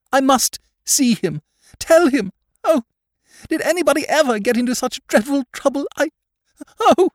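hysterical, very high woman's cries; distraught lady working up into hysterics; desperate vehemence. A narrator's reading. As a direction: wail brokenly, rising to a loud "oh". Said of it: "with desperate vehemence".

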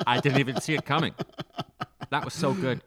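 loud, laughing delight; amused praise. Real, not acted. Co-host applauding a segue, laughing praise.

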